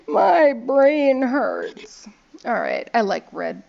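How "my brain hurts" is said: loud, very drawn-out complaint; overwhelmed, exaggerated slow wail; playful whine. Real, not acted. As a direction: stretch every word out, starting high and sliding down. Mock complaint after confusing card rules.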